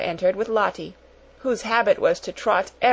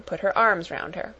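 plain reading voice

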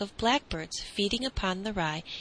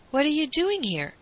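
higher, mildly rough challenge; 7 semitones higher, 4 dB louder than usual. A narrator's reading. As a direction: ask a touch roughly, pitched up. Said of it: "he asked roughly".

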